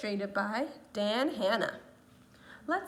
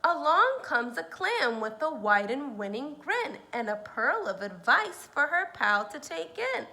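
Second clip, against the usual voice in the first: put-on lively read-aloud to children; story reading; 3 semitones higher, 8 dB louder than usual. A narrator's reading. Teacher reading a picture book to her students, lively. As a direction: read it like a storybook for little ones.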